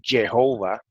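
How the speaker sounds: Jehová said slowly as "ye-HO-va"; Jehová with Y for J and a long O. Shown as a demonstration. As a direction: open Jehová with a Y sound, unhurried. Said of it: "pronunciando la J como una Y".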